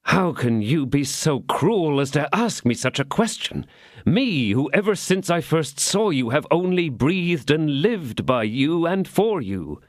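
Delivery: lovesick and pleading, slowing at the end; sentimental. Acted. D'Artagnan wooing her ladyship, sentimental and pleading. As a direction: pour on the sentiment like a practised suitor. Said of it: "assuming the most sentimental manner".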